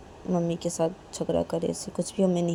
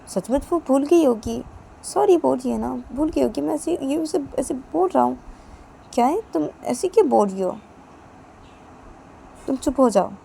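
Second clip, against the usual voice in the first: loud, high heated argument; angry retort; 7 semitones higher, 6 dB louder than usual. Acted. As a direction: snap back and shut her up. Father argues back at wife.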